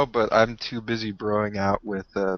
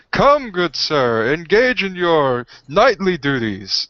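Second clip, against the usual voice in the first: gallant hero; 5 semitones higher, 3 dB louder than usual. Acted. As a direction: summon a knight grandly to battle. Player calls on knight ghost.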